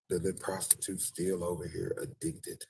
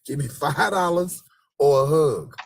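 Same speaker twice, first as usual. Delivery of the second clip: louder, slow demand; mock beggar demand; 7 semitones higher, 10 dB louder than usual. Beggar pitch to strangers, loud slow. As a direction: insist on the money or a hug.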